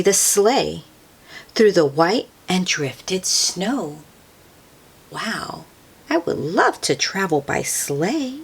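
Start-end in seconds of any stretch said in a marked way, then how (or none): none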